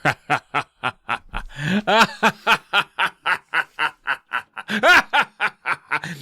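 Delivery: hearty villain laugh. Acted. Uncle Al laughing at Billy's blank shot, long and hearty. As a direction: laugh it off, amused. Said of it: "And Uncle Al laughs".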